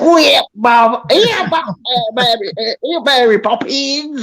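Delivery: hammed-up bad Cockney, pitched high; mangled, exaggerated stage Cockney. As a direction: do a terrible high Cockney accent.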